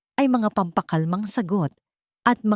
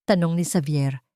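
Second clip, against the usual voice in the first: usual speaking voice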